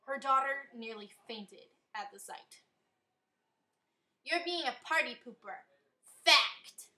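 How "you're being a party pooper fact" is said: loud yell on Fact. A narrator's reading. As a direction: yell the last word. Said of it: "the booty-shaking mom yelled".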